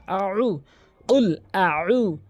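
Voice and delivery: উল, আউ slow and chanted; slow Quranic recitation; recited qul a'udhu. Shown as a demonstration. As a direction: recite উল আউ slowly with throat letters. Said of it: "আইন চাপ দিয়ে হলকের মাঝখান থেকে".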